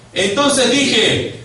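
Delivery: high, loud, drawn-out lead-in; loud pulpit cue; shouted reading. Shown as a demonstration. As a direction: call it out slowly for someone to answer. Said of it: "grítelo".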